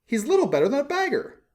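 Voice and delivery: the mayor looking down on the statue; sneering mayor. A narrator's reading. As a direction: sniff it out with pompous disdain. Mayor disdains shabby statue.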